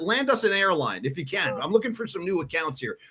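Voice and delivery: normal talking voice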